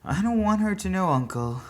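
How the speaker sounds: put-on a boy begging firmly; insistent boy. A narrator's reading. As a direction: press the point urgently.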